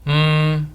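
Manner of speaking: "Mm" hummed on one high, level pitch; sustained "Mm" like a held musical note; flat tone, lips closed. Shown as a demonstration. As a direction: hum "Mm" evenly with lips shut. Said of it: "let's say the word with our lips closed".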